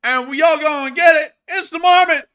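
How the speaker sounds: loud, high mock rodent shouting "Hey!"; shouty Marmot. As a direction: bellow like a cocky cartoon rodent. Voicing the Marmot character.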